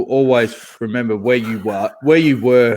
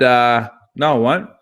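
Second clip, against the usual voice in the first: firm refusal. Retold or imitated. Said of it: "And I said".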